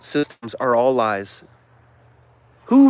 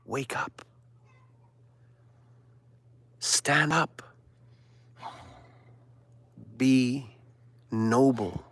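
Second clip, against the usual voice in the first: softer, near-whispered commands; hushed urging; 4 semitones lower, 9 dB quieter, much slower than usual. Acted. Preacher urging listeners in a hushed near-whisper. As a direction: murmur it like a secret call to rise.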